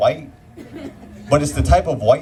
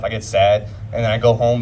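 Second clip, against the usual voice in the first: normal talking voice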